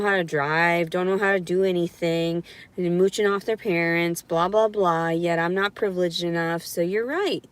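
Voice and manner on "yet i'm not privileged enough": ordinary delivery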